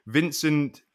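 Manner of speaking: put-on slow, drawn-out French accent on the name; hammed-up French accent; mock French accent. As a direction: announce it like a French commentator. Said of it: "I should stop doing it in that accent".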